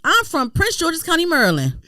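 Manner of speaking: correcting him firmly; insistent. Retold or imitated. Insistent quoted reply.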